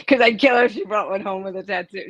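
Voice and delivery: laughing through the joke; amused mock threat, laughing. Real, not acted. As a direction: chuckle all the way through it. Joking about daughter's tattoo.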